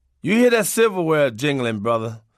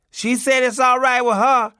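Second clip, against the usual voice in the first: put-on high, louder kid voice relaying the message; child messenger; 9 semitones higher, 6 dB louder than usual. Little kid relays daddy's refusal to mom, high child voice. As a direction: pass it on like a small child would.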